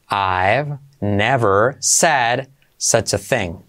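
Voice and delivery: slow, deliberate teaching pace, each word separate. Shown as a demonstration. Teacher to Spanish-speaking students, slow dictation pace. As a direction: spell it out slowly for learners. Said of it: "teacher models the sentence slowly".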